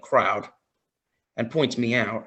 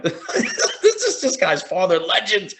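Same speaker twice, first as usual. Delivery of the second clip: slow, higher, laughing through the words; laughing in awe; 7 semitones higher, much slower than usual. Retold or imitated. Telling how the wrestler introduced him as his dad, slow laughing awe. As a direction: marvel at it while laughing.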